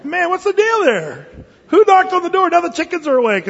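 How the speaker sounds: loud, high, irritated neighbor woken up; annoyed grumbling. Acted. Woken neighbor complaining about the knock at midnight, loud and irritated.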